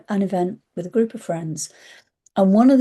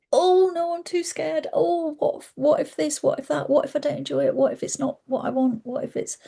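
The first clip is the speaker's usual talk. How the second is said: much higher, fast frightened what-ifs; mock scared; 8 semitones higher than usual. Herself backing away from the event, high mock panic. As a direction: play a panicking inner voice. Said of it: "Or was I gonna go".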